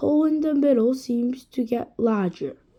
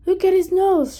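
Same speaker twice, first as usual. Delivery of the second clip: put-on higher, louder kid's shout; 7 semitones higher, 7 dB louder than usual. A narrator's reading. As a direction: call out the alarm to the class. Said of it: "shouted Eric Bacon".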